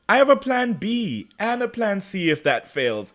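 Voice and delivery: high, singsong smugness; mock villain gloating. Catherine gloating to Damon about backup plans, high mock gloating. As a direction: list your schemes with lilting villain relish.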